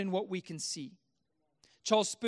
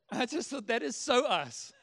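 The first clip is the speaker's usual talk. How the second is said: ordinary talk, nothing marked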